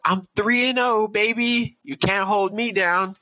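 strut in and gloat at everyone; swaggering high-pitched boast; cocky brag. Retold or imitated. Bragging entrance to the company.

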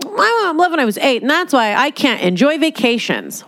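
high, sassy and dismissive; cunty way. Retold or imitated. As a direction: toss it off as a snotty joke. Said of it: "If you say it in a cunty way".

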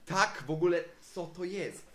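loud, high-pitched amazement; excited outburst. Real, not acted. Podcaster marvels at a teacher admitting error, excited outburst. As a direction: exclaim it at full voice, astonished.

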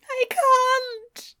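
very high, cracking whimper; near tears. Acted. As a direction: plead with a breaking voice. Refuses to calm down.

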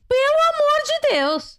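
high, drawn-out "for God's sake"; mock pleading, voice shooting way up; exasperated exclamation. Host reacting to guest's midnight work confession, mock exasperated.

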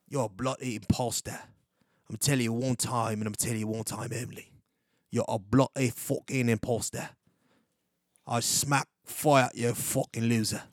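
put-on British accent, threatening. Host showing off accents, telling off an imposter in British accent. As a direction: insult them in a mock British accent.

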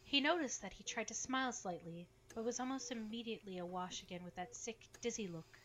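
story narration. A narrator's reading. Narration between character lines.

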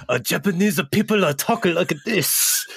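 put-on high, loud caricatured Japanese accent; over-the-top fake Japanese accent; mock Japanese accent. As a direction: imitate a coworker's hammed-up Japanese accent bit. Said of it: "she did a funny accent".

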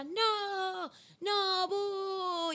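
an audience booing the heckler; crowd booing. Retold or imitated. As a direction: boo like a rowdy audience. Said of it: "la gente".

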